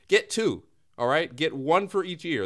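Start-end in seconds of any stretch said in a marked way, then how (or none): none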